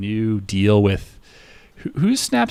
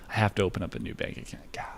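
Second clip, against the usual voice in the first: real whisper; 3 semitones lower, 9 dB quieter, faster than usual. Real, not acted. Muttering to himself about bank.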